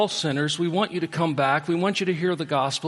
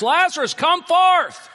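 high, loud cry to the tomb; screamed command; 10 dB louder, slower than usual. Retold or imitated. Preacher imagining crying at Lazarus's tomb, loud shout. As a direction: call him out at full voice. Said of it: "I could scream to the top of my lungs".

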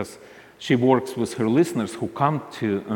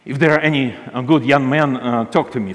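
louder, higher appeal to the listeners; loud call-out; 4 semitones higher, 9 dB louder than usual. Retold or imitated. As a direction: call out over the airwaves, half joking. Ina calling out on air.

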